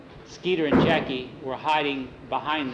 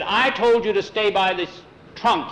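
stern scolding; 6 semitones higher, 6 dB louder than usual. A narrator's reading. Tom scolds disobedient boys.

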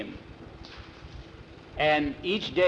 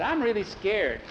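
mildly fearful, raised pitch; scared boy; 7 semitones higher than usual. A narrator's reading. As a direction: confess it with a worried lift. Bowie confesses fear.

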